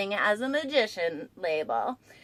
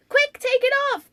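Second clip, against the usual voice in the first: high, louder urgent cry; cried urgently; 11 semitones higher, 5 dB louder than usual. A narrator's reading. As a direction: panic rising in the voice. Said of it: "cried Mole".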